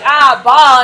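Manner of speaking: loud, acted realisation; kid finally getting it. Kid finally copying the teacher, loud acted realisation. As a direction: boom it out as a kid catching on. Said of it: "And the kid's like".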